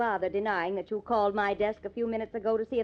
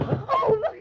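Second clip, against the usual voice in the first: shrill gasp of fright, much higher and louder; startled cry; 8 dB louder than usual. Acted. Reporter startled on finding the captain collapsed, frightened cry. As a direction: jolt at the sight of him.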